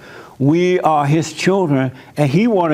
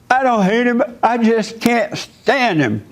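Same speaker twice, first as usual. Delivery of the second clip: higher, slowed mock protest; mimicking cousin; 7 semitones higher than usual. Retelling the phone call, cousin's denial in a higher mock whine. Cousin's reply voiced in story.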